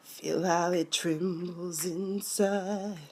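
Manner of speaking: slow, soft, breathy sultry croon; hammed-up slow sultry voice; mock seductive singer. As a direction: purr it out like a torch singer. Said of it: "And I was like".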